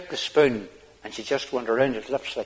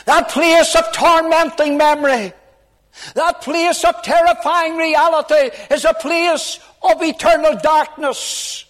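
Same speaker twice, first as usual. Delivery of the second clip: preaching at full force; 9 dB louder than usual. Acted. Preacher pleading for sinners in hell, loud and high. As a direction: thunder it out from the pulpit.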